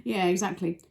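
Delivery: plain reading voice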